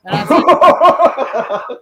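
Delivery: real laugh breaking through the words; loud, slow, laughing out the words. Real, not acted. Laughing while retelling the fight.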